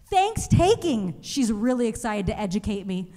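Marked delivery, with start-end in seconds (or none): 0.1-1.2 slow, higher drawn-out Thanks-taking; eager classmate. Retold or imitated. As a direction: say it slowly like teaching. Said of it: "And she goes".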